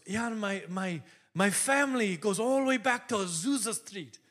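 imitated Irish lilt; lightly voiced Irish accent. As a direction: play an Irishman telling his family history. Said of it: "He's got this great accent".

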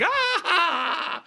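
very high mock wail; imitated friend's reaction. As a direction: cry out as the outraged friend.

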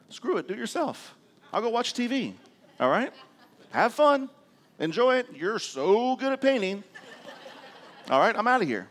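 sarcastic outburst. Retold or imitated. Fed-up husband quitting the painting job on his wife, sarcastic. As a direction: storm off with loud, high-pitched sarcasm.

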